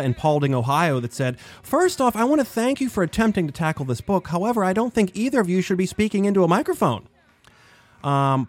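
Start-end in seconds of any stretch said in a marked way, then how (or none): 1.6-7.1 mock letter writer. Reading a critical listener letter.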